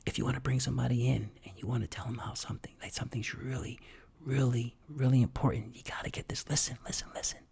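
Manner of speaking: hushed, urgent confidential whisper. Shown as a demonstration. Coach showing how to bring listeners closer, whispering. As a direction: drop to a whisper to draw them in. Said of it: "because you're whispering".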